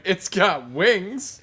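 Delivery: slow deadpan imitation. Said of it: "Brahma was like".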